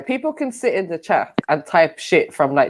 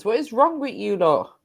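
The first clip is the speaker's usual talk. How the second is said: ordinary talk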